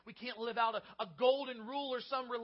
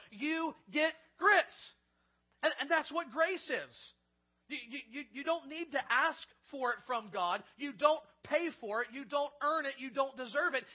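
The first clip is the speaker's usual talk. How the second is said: rising, louder preacher's build to a peak; fervent sermon climax, louder and higher; preaching at full force; 5 semitones higher, 8 dB louder than usual. Acted. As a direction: drive it home from the pulpit. Preaching about grits and grace.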